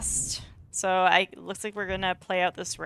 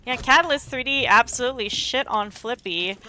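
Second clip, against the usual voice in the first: esports caster; 5 dB louder, faster than usual. Acted. Live esports match commentary.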